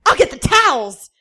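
high, loud eager burst; loud, high, thrilled offer; excitedly. Acted. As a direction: jump at the chance. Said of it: "Danny said excitedly".

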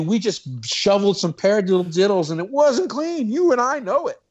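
high-pitched, hammed-up indignation; mock outrage. Drummer mock-scolding a judge over unclean playing, high indignant outrage. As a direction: scold him in a theatrically scandalized high voice.

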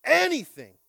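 Anything shouted high and loud; loud call and response. Shown as a demonstration. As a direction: belt out Anything. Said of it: "Somebody say".

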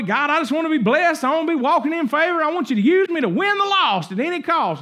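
a keen believer begging God to use him; eager prayer. Retold or imitated. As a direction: pray it like you can't wait.

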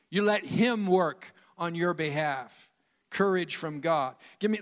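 usual speaking voice

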